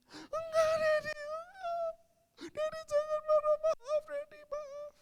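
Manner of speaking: very high, squealed sobbing plea; mock tearful wailing; imitated crying child. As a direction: beg forgiveness like a weeping kid. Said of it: "Sambil nangis".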